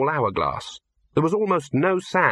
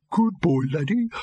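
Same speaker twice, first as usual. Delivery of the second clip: Gaspode, gentle; 7 semitones higher than usual. Acted. Gaspode to Laddie.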